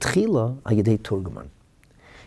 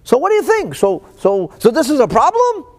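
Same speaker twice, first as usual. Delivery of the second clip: flustered king; 8 dB louder than usual. Retold or imitated. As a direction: hem and haw like a rattled king. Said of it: "And he's like".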